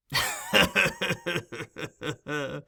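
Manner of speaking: put-on wheezy old man's chuckle; chuckling fit. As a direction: shake with chuckles. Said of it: "a fit of chuckling".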